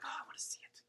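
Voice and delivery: breathy, eager whisper. Retold or imitated. Telling candidates she wants to see the new product, whispered. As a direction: hush it like a kid craving a peek.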